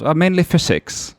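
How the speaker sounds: put-on Dutch or German accent. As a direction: play the Dutch-German stranger proudly. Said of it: "overdoing the accent".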